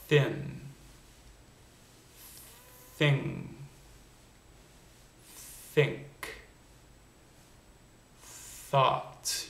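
"Thin", "Thing", "Think", "Thought" each start with a long airy th, tongue between the teeth; voiceless TH. Shown as a demonstration. Accent coach modelling the voiceless TH for learners to repeat, airy. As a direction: blow a thin stream of air on every th. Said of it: "Let's start with the voiceless T H".

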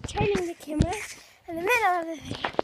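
ordinary talk

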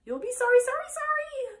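very high, loud honking warning; shrieking goose. A narrator's reading. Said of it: "cried the goose".

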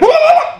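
high, hammed-up cackle; mock dumb laugh. Comic showing how Americans laugh, high mock cackle. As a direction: fake a goofy laugh, way up high. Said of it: "if I did that same joke and went".

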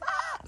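sharp high fangirl squeal. Pretend. Girl thrilled that the cute guy wants to meet, high squeal. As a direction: let out a short excited shriek.

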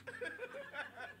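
a high giggle; high laugh. Real, not acted. Laughing at flower joke.

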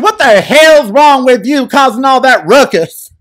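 put-on hollering at a noisy kid the way mama would; mock mama yell. French mother scolding her child, a loud mock yell. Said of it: "My mama would have walked in and said".

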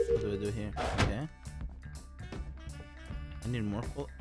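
ordinary talk

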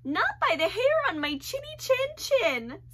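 high squeaky pig voice; frightened little pig; panicked pig squeal. Acted. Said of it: "squealed the panicked pig".

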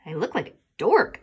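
whispered under the breath; sulky whisper. Acted. Boy grumbling back at mom's compliment in a whisper. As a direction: mutter it so mom barely hears.

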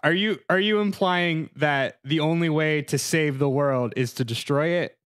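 ordinary talk, nothing marked

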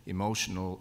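ordinary talk, nothing marked

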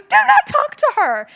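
put-on high, loud bossy little boy; child's order. Mother imitating her son telling his brother to go away. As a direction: mimic a toddler guarding his mom.

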